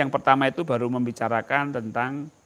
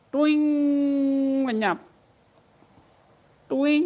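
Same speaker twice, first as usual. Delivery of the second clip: ringing tuing sound; 10 semitones higher, 3 dB louder, slower than usual. Shown as a demonstration. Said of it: "latihannya sering begini".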